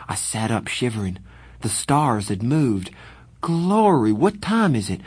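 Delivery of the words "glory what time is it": put-on higher, louder startled gasp of a boy; startled waking. Pony waking to moved stars, startled and higher. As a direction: jolt awake in alarm.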